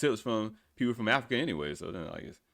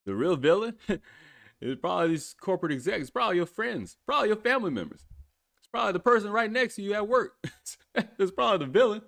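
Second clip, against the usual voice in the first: giggling through the words; can't stop laughing; 7 semitones higher than usual. Real, not acted.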